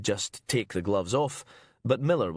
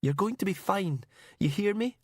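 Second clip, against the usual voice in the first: high, pleading and urgent; desperate reassurance; 11 semitones higher than usual. Acted. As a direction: keep him awake at all costs. Heather urges dying Duncan.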